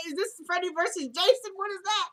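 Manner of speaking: high, laughing, mock-spooked; laughing disbelief. Joking about a horror movie while laughing, high pitched. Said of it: "I'm looking around like".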